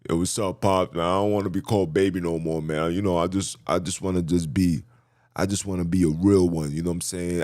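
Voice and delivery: low mock-masculine street kid; put-on deep boyish voice; daughter turned boy. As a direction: play a deep-voiced youngster pleading with Pop. Playing daughter who now talks like boy.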